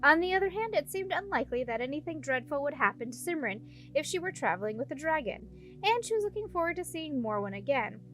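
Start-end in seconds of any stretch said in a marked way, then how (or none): none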